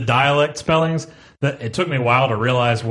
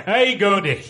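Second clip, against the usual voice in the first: Scottish greeting; 6 semitones higher than usual. Shown as a demonstration. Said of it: "I think there'd be more of an R".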